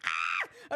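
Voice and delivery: short, sudden yelp; excited scream. Real, not acted. Fan overwhelmed by the game, screaming briefly. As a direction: let out a fangirl shriek.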